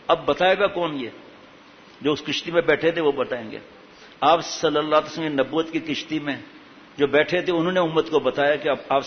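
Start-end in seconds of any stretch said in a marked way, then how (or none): none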